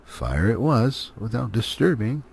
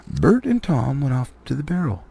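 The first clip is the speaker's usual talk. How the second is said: ordinary delivery, nothing marked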